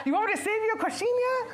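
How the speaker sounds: high, loud laughing question; laughing offer. Pretend. Offering food to a friend, laughing. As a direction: giggle the offer out.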